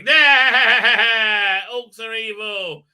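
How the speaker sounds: exaggerated wail stretched long; hammed-up elf outburst. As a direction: imitate a daft zealot crying out. Imitates an elf's defiant outburst.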